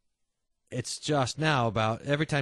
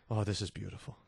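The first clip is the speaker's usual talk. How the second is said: hushed awe; 5 semitones lower, 7 dB quieter, faster than usual. Real, not acted. Awed aside.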